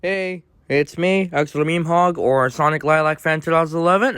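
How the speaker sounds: ordinary delivery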